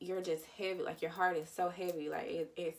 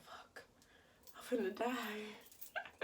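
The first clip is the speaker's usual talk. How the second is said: breathy dread; 3 semitones higher, 5 dB quieter, much slower than usual. Retold or imitated. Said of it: "I'm like".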